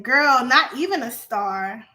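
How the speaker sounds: ordinary delivery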